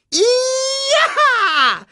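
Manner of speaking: loud, very high whoop; high-pitched cry. Acted. Meerkat attacking the startled vultures, high loud whoop. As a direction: whoop it long at the top of your voice. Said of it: "rief das Erdmännchen wieder".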